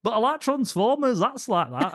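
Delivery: high, whiny protest; exaggerated high-pitched sibling impersonation; mocking sister. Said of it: "she was like".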